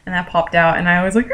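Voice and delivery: normal talking voice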